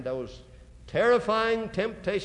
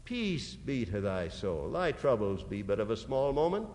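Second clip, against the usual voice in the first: put-on much softer and lower, soothing a frightened child; tender comfort; 10 semitones lower, 6 dB quieter than usual. The Lord comforting Joseph Smith, soft and tender. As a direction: hush it like holding a scared child. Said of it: "as though he'd taken a frightened child in his arms".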